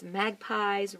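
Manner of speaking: plain reading voice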